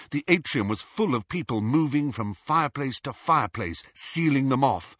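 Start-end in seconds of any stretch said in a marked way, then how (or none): none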